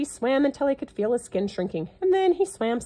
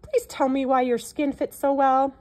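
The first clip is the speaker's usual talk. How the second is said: higher, louder call across the water; 5 semitones higher, 4 dB louder than usual. A narrator's reading. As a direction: shout a polite question to the crocodile. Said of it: "The little elephant called out".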